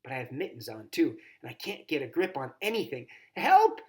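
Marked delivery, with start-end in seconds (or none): 3.4-3.8 high, loud gasp of a cry; girl crying out for help. A narrator's reading. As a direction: cry out, smothered by coats. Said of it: "I gasp".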